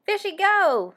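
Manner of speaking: put-on high, loud toddler squeal; baby squeal. A narrator's reading. Baby squeals an answer to the family, high and excited. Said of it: "squeals the baby".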